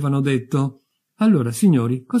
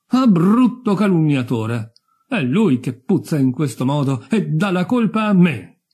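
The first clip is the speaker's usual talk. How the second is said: affronted outburst, higher and louder at first; indignant accusation; 5 semitones higher, 5 dB louder than usual. Acted. Dead lady rebukes the neighbour who insulted her, indignant.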